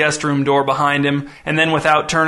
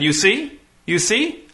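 high, eager "you see?"; eager visitor voice; 6 semitones higher, slower than usual. A narrator's reading. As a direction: press the point gleefully. Visitor points at mother.

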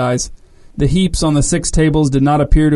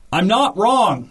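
screamed; 6 semitones higher, slower than usual. A narrator's reading. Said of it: "screamed the man with a sudden burst".

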